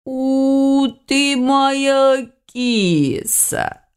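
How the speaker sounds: high, very drawn-out coo; cooing over pet. A narrator's reading. Witch reaches out to the cat, cooing. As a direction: gush slowly over a beloved kitty, high. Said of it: "зашептала Арина".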